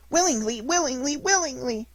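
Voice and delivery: high, loud, bouncing repeats; overjoyed child agreeing; eager agreement. Acted. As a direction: say it three times, rising with glee.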